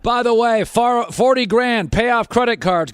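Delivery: upbeat radio ad voice; ad read. Acted. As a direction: sell the loan offer hard.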